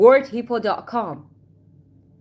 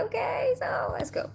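whisper; 13 semitones higher, 7 dB quieter than usual. Real, not acted. Teacher switches to whisper aside.

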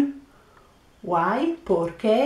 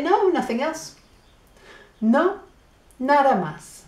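higher, lightly voiced customer answer; playing the customer; 6 semitones higher than usual. Shown as a demonstration. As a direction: answer the waiter in a brighter customer voice. And then you say.

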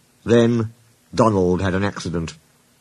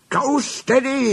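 yelled; slower than usual. A narrator's reading. Anxious guard warning Douglas, yelling from afar. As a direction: stretch it into a high warning call. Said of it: "he yelled to Douglas".

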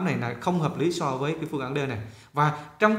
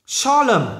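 "Solemn" high and loud on the first syllable; nhấn âm đầu; 8 semitones higher, 6 dB louder than usual. Shown as a demonstration. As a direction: punch SOL in "Solemn". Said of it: "nhấn âm đầu". ("Solemn" nhấn cao, mạnh âm đầu)

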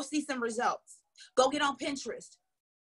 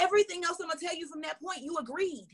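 ordinary delivery

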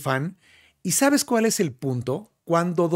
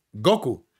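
Goku said GO-ku, falling away after GO; stress on first syllable; 4 semitones lower than usual. Shown as a demonstration. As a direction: punch the O in Goku. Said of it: "llevaría el acento en la O".

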